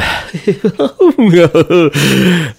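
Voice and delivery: loud, high cackling laugh; mad laughter. Real, not acted. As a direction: burst into wild laughter. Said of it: "mad laughter".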